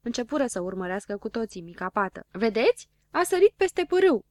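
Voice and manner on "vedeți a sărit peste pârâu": high, excited yell. A narrator's reading. Zăpăcilă watches the spot jump the stream, yelling. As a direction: cry out what you just saw. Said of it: "țipă Zăpăcilă".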